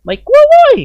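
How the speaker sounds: high, loud defiant yell; defiant shout. Acted. Khun Ying Wad yelling she fears no spirit, high and loud. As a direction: shout your defiance to the sky. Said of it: "คุณหญิงวาดแผดเสียงลั่น".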